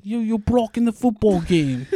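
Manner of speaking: hammed-up British football fan complaint; mock British accent. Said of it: "they're not British".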